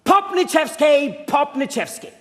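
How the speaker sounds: slow, loud call of a name; yelling the name. Shown as a demonstration. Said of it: "yell at the top of your lungs".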